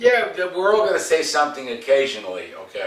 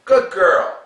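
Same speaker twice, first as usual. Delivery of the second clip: eager praise; 9 semitones higher, 10 dB louder than usual. Shown as a demonstration. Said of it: "Don't go".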